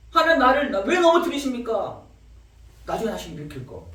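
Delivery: high, loud pleading challenge to God; demanding protest. Retold or imitated. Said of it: "하나님께 막 질문을 합니다".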